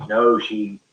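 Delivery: ordinary talk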